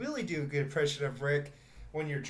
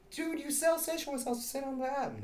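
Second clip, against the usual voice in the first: insistent; 12 semitones higher than usual. Retold or imitated. Retold argument with cashier.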